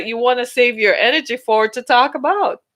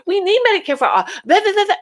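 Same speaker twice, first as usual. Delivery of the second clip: higher, mock-preachy rant; mock political rant; 8 semitones higher, faster than usual. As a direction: rattle off a partner's political pitch. Acted couple arguing about politics.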